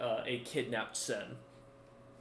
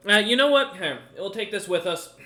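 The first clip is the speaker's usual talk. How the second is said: louder, higher burst of resolve; loud, pitched-up change of mind; abrupt decision; 7 semitones higher, 10 dB louder than usual. Acted. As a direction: give up and decide on the spot. Bard gives up on the book.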